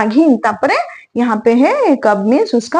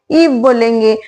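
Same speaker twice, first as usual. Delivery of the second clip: ordinary talk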